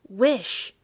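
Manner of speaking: loud, high, insistent cry; repeated command. A narrator's reading. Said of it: "repeated his wife".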